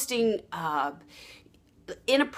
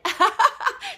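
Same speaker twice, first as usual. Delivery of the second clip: high burst of laughter at herself; real laugh; 13 semitones higher, 5 dB louder than usual. Real, not acted. Coach laughing after mixing up her words, real laugh. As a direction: laugh off the slip.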